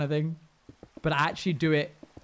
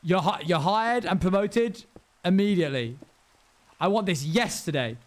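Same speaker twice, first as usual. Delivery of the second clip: excited boss; 4 semitones higher, 4 dB louder than usual. Retold or imitated. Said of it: "And they were like".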